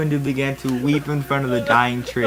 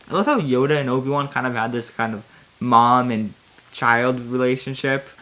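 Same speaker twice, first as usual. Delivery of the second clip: normal talking voice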